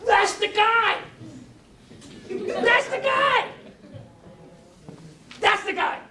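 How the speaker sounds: high, loud and overjoyed; excited recognition. Retold or imitated. Woman recognising the speaker, shouting with excitement. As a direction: cry out as you spot him. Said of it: "She said it like seven times".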